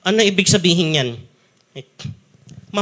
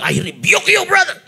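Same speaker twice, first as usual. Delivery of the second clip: pious church rebuke; 12 semitones higher than usual. Shown as a demonstration. Preacher mocks how Christians rebuke, hammed-up shout. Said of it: "Re-rebuke".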